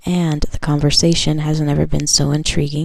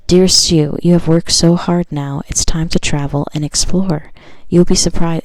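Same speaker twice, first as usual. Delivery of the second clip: louder, declaiming card voice; card reading; 5 dB louder than usual. Acted. Tarot reader reading the card message to viewers, declaiming. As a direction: read the card like a proclamation.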